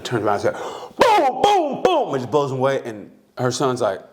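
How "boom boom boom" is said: high, loud mock gun booms; imitated gunshots. Retelling a mother shooting a man, imitated gunshots. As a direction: fire off booming shots by voice. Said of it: "she gets a gun".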